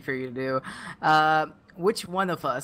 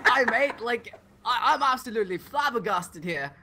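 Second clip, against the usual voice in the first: higher, laughing put-on British accent; mock British accent; 5 semitones higher than usual. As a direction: splutter it like a shocked Brit, giggling. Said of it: "if we're doing fake accents".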